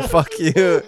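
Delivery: swearing through a laugh. Real, not acted. Host curses co-host who was kidding, laughing. Cracks up at the joke.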